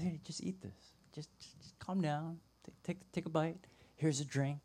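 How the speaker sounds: very soft, tender coaxing; gentle soothing. Acted. God to depressed Elijah, offering food, softly soothing. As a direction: hush your voice to calm someone down.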